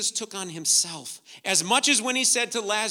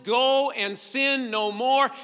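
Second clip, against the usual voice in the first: slow emphatic quote; 5 semitones higher, 5 dB louder, much slower than usual. Retold or imitated.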